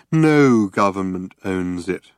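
ordinary delivery, nothing marked

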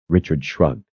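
ordinary delivery, nothing marked

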